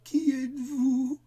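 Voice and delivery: high, loud, slow scared question; children trembling, demanding who's there; frightened cry. Acted. Children asking the monster who he is, high and frightened. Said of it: "tremblant toujours de peur".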